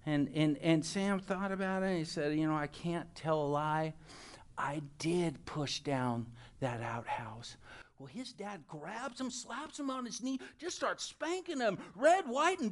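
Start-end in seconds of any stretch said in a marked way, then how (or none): 2.4-7.6 low, slowing admission; mock-earnest child confessing; solemn confession. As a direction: own up gravely, word by word. Sam confesses to his dad.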